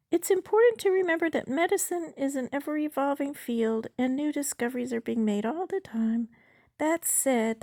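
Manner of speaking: high-pitched bot character; chatbot voice. Acted. Chatbot lectures user on medicine, high bot voice.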